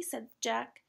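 ordinary delivery

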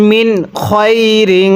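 drawn-out Quran reading; slow recitation. Shown as a demonstration. Teacher reciting the next phrase to students, slow chant. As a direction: recite it slowly and clearly. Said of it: "এরপরে".

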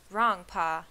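loud contradiction; shout. A narrator's reading. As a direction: shout back to correct Pa. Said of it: "he shouted".

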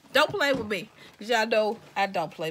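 loud sassy warning; playful don't-mess-with-me; mock tough, loud. Real, not acted. Sassy hype to viewers.

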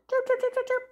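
very high squeaky chirping; dolphin chirps. Shown as a demonstration. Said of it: "Dolphin sound".

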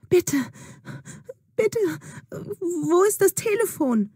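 high, anxious begging; scared girl begging the old woman for a phone; frightened pleading. Acted. Juna begs, fear returning.

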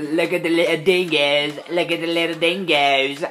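high, loud mock-Aussie voice; bad Australian accent. Shown as a demonstration. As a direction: ham up a badly faked Australian accent. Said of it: "I can't do an accent".

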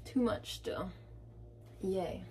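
usual speaking voice

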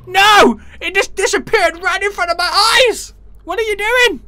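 high, loud outraged shout; loud, high cry of outrage; shocked yell. Real, not acted. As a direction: yell in disbelief as it vanishes. Stuff vanishes before his eyes.

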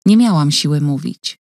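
plain reading voice

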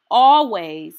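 first always, loud and strong; emphatic always. Acted. Reading to a child, a loud emphatic always. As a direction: declare it firmly to the reader.

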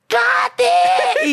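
high-pitched acted shout of the name; alarmed shriek. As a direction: cry out as the horrified friend. Friend cries out seeing her fall.

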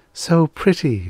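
high-pitched dreamy sigh of delight; sighing admiration. A narrator's reading. Airy creature admiring flowers, sighing delight. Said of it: "she sighed".

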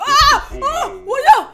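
very high, loud yelps; startled scream. Real, not acted. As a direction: scream out in sudden shock. Jump scare in the game.

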